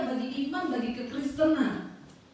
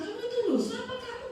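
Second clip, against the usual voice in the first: higher-pitched mock scolding; scolding sibling; 10 semitones higher, faster than usual. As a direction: imitate an indignant younger sibling, pitched up. Sibling scolds her over converting.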